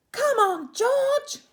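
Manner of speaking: very high, loud chorus; excited animals. Acted. Animals urging George to open the gift, very high and loud. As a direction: squeal it eagerly.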